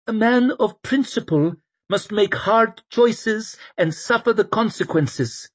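echoing quote. Acted.

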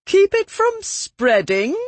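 scoffing, mocking disbelief; derisive. A narrator's reading. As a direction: sneer the question. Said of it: "Lady Shrewsbury laughed derisively".

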